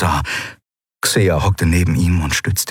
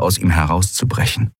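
ordinary delivery, nothing marked

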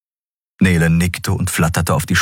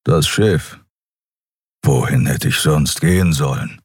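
lower, gruff voice; gruff Skria; 3 semitones lower than usual. Acted. Kero explaining why he stayed, gruff and low. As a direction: keep it low and curt.

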